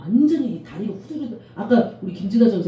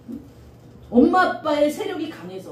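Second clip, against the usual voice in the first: ordinary delivery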